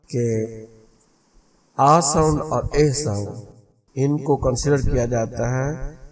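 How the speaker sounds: usual speaking voice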